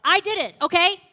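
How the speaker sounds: high, exploding mock shout; hammed-up fed-up outburst; exasperated confession. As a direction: blurt it out loudly, like someone who has had enough. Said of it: "had just had enough".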